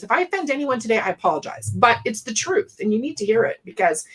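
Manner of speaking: ordinary talk, nothing marked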